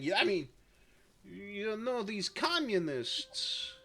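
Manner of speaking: halting, badly faked French accent; hesitant mock-French accent; terrible French accent. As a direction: imitate a French leader in a bad French accent. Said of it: "I did a terrible French accent there".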